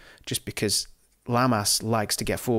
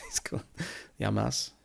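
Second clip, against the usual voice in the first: ordinary talk, nothing marked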